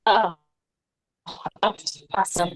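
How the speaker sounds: ordinary talk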